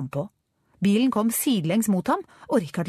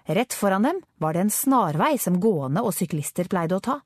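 usual speaking voice

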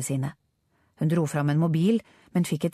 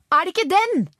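high, loud urgent question; high-pitched cry of discovery; excited shout; 12 semitones higher, 5 dB louder than usual. A narrator's reading. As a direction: shout it as if spotting the target. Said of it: "ropte Angela og pekte".

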